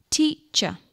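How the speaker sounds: Teacher in two beats, high start; syllable demonstration. Shown as a demonstration. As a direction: separate Teacher into tea, cher. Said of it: "How many syllables?".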